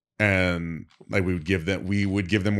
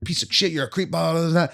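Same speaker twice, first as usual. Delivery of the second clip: retold yelling; 9 semitones higher than usual. Fired dancer yelling at the manager, retold rant. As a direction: rattle off insults in imitation. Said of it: "she's just yelling at him".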